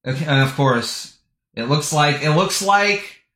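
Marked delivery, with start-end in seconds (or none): none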